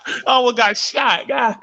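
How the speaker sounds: laughing it out, slow and high. Real, not acted. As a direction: say it while cracking up.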